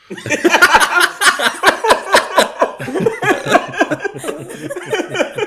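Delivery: loud, high, helpless laughter; big laughing fit. Real, not acted. Friends crack up at a crude joke, uproarious laughter. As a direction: burst out laughing long and high.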